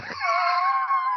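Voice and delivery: high-pitched burst of laughter; real laugh. Real, not acted. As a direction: crack up at your own memory. Cracks up at his own memory.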